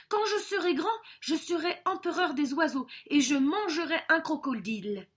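high, excited little bird; eager young César. Acted. César tells his father his dream, high and eager.

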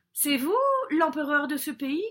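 high, loud, chirpy question; bold young César. Acted. César opens conversation with the crocodile, high and bold. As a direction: pipe up boldly to a giant.

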